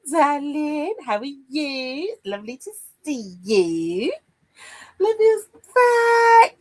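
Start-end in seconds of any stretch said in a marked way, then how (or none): 5.0-6.5 high, loud, drawn-out cheer; delighted cheer. Real, not acted. Host cheering a friend back in chat, slow and high. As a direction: stretch it out with glee.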